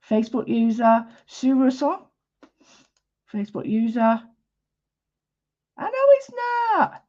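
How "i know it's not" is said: very high, drawn-out squeak; high-pitched protest. Real, not acted. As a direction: stretch it out way up high, then drop. Comic reply to viewer's comment.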